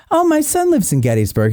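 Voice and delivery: very high, excited mom impression; mother's voice. Mother tells Irishman about her son, affected high voice. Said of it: "annoying affected, uh, voice".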